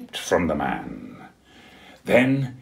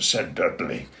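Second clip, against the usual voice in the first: plain reading voice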